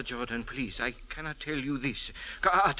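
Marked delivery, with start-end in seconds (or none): 2.4-2.8 sudden higher, louder outcry; alarmed exclamation. Acted. Sand diviner recoiling from his own reading, alarmed outcry. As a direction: blurt it out in alarm.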